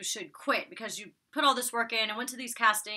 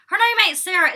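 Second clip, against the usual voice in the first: high, loud amused outburst; real exclaiming correction; 8 semitones higher, 10 dB louder than usual. Real, not acted. Vlogger calling out a castmate's wrong name, exclaiming. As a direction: blurt the correction out loudly.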